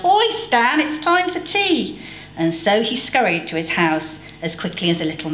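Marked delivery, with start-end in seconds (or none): 0.1-2.0 high call across the garden; yelling mum. A narrator's reading. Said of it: "and yelled".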